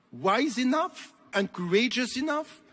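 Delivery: ordinary talk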